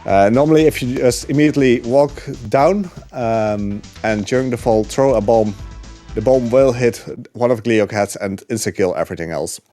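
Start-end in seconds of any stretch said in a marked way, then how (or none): none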